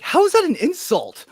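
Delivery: high, loud disbelieving cry; can't believe it's an insult; incredulous. Retold or imitated. To the coworker about the weight remark, incredulous. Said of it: "I'm like".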